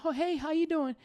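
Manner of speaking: fake-cheerful high greeting; overly cheery guy. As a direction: ham up a too-happy neighbor. Imitating an always-happy guy.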